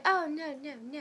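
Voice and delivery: mock indignant protest, starting high and loud; retold yelling. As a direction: imitate her cutting in angrily. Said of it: "everybody was yelling".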